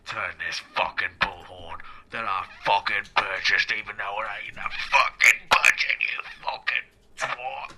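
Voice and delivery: low gruff producer voice; deep mock-tough rant; Neil Marshall voice. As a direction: growl it like a stingy boss. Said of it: "I'm Neil fucking Marshall".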